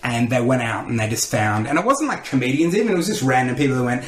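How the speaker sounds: ordinary delivery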